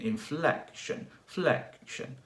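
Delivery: "flec" starting at the top and dropping; falling stressed FLEC. Shown as a demonstration. Teacher likening stress to fourth tone, "flec" falling from high. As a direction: hit "flec" high in "inflection" and "flexion", then fall. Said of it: "starts from the top and goes down".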